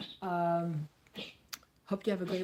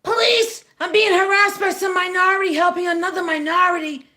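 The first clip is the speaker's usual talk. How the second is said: shrill-pitched, much higher and louder outraged Karen; Karen calling police; 10 semitones higher, 11 dB louder than usual. Retold or imitated. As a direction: scream for the police like an entitled Karen. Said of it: "she was a Karen".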